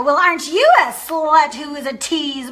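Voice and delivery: mock a rude date's insult; mocking sneer. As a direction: sneer it at them slowly. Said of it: "And then they might go".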